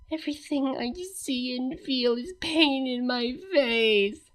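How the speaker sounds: soft, breathy whisper of pain. A narrator's reading. Cadance answering Luna in a faint whisper. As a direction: barely voice it, dazed. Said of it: "her voice was but a whisper".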